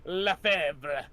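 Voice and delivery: put-on villain Lefebvre gloating in French accent. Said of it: "waiting for this accent".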